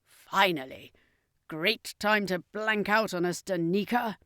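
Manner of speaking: a touch of angry sarcasm from the circus master; mildly annoyed jab at Danica; understated irritated character voice; said angrily. Acted. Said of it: "the circus master said angrily".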